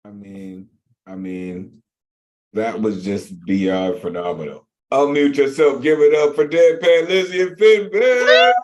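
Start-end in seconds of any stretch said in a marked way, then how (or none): 4.9-8.5 loud, climbing call for applause; real emcee shout building higher; hype host shout. Real, not acted. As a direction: holler it to the crowd, rising to the names.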